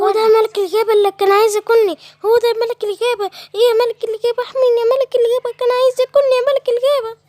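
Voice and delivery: high, loud, fast pleading; frantic chicken. Acted. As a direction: throw herself on the king's mercy. Chicken runs to the lion.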